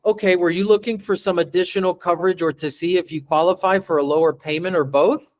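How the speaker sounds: fast, rambling nervous question; hurried script reading; nervous rushed read. Shown as a demonstration. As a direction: rush it like a nervous new rep. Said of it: "You see the difference in that?".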